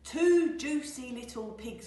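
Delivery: loud cry from a greedy wolf; wolf crowing over his meal; gloating cry. Acted. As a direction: call it out with relish. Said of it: "cried Wolf".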